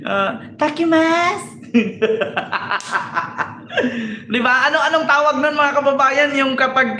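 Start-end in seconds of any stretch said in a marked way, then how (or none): 0.1-1.7 slow, much higher cutesy mock Japanese. Parodying Japanese women, slow high cutesy mock Japanese. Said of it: "Ganun pag Japanese".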